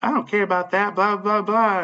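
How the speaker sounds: high-pitched mocking parrot of someone; sing-song mimicry, high; mocking retort. Mocking retold argument reply.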